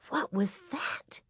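frightened, hushed little whisper; thin scared whisper. Acted. Carrie to her sister after the bang, scared whisper. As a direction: whisper it small and fearfully. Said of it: "asked in a thin, scared whisper".

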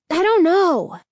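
high, angry cut-in; angry interruption. A narrator's reading. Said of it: "Danny interrupted angrily".

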